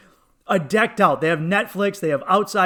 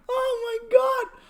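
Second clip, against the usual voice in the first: very high, breaking out of laughter; real helpless amusement; cracking up; slower than usual. Real, not acted. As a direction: squeal it through the tail of a laugh. Cracking up at own story.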